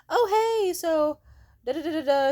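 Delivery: high, loud fake-cheery voice; mocking manager. Mocking the manager's fake-cheery text in a high, loud voice.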